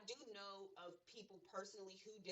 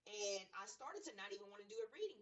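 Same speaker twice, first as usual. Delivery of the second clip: normal talking voice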